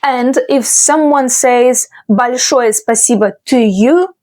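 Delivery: ordinary talk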